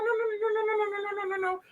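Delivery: retelling refusing the candle in a high squeal; frantic high refusal. Retold or imitated. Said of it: "I was like".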